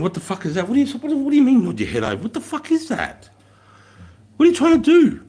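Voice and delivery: mock incredulous yell, climbing high; retold outrage. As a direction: blast the recruit with rising disbelief. Retold berating of recruit.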